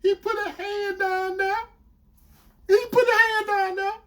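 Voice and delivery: very high mimicked wail; confessing girlfriend. Girlfriend confessing where his hand went, imitated high-pitched. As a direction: repeat it in a high, strained imitation.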